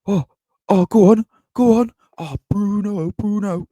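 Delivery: high, loud, eager cheer; squeaky over-the-top fan cheering; mock excited cheering. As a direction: imitate a fan urging his player on. Fan cheering Newcastle's attack.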